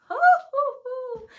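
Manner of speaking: very high, loud joyful hoots; piercing praise whoops; ecstatic whooping. Real, not acted. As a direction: whoop out with delight.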